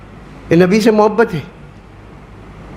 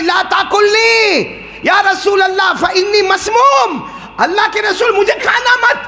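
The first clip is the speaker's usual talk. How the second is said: high, loud urgent call; loud warning cry; 13 semitones higher than usual. Acted. Poisoned morsel warns the Prophet, loud cry. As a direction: cry out a warning so all hear. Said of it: "اتنی زور سے آواز آئی کہ سب نے سنا".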